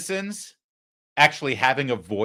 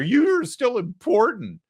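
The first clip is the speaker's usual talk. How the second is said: higher, slow mock-flattering; 9 semitones higher, slower than usual. Host mocking press dinners, slow and sneering. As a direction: sneer it like a fawning toast.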